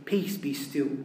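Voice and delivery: soft and low, stilling the sea; calm command. Acted. Christ calms the stormy sea, soft low command. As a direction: quietly command the waves.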